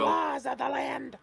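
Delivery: high, slow shouting kobold; squeaky yappy lizard chatter. Acted. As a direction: yap the question like a lizard. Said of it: "have you guys ever heard my kobold voice".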